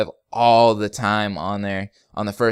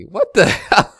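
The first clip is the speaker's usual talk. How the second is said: laughing disbelief; 8 semitones higher, 6 dB louder, slower than usual. Real, not acted. Shocked by question.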